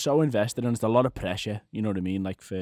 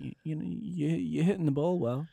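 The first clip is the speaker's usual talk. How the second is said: mock coach; 4 semitones higher, 5 dB quieter than usual. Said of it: "the coach is just like".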